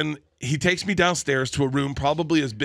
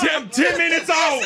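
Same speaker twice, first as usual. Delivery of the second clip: mock panicked shout; 13 semitones higher, 4 dB louder than usual. Panicked retold reply, shouted.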